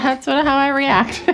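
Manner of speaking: ordinary talk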